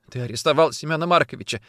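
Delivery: a touch of sobbing in the words; mild tearful sob. A narrator's reading. Woman accuses officer of the arrest, mildly sobbing. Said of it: "всхлипывала она".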